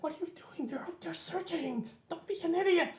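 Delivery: scolding in a hushed, whispered voice; urgent whisper. Acted. Scolding a companion over searchers outside, urgent whisper. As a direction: whisper a sharp telling-off. Scolding someone while hiding.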